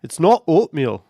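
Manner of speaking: jokey Scottish put-on voice; awful Scottish accent. Shown as a demonstration. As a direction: do a bad Scottish accent, loudly. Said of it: "that was an awful Scottish accent".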